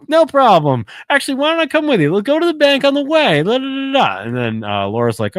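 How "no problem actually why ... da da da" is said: high, fast, giddy Carl impression. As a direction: squeak it out, way too cheerful and rushing. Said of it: "He's like".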